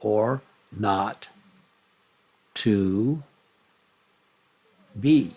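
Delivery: slow, spaced, "be" lifted; drawn-out iambic beat; iambic stress. Shown as a demonstration. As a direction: pause between each word, land on "be". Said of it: "repetition of that same pattern".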